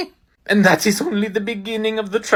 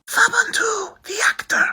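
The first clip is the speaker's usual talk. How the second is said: high hurried whisper. Acted. Hurried reminder of fake name.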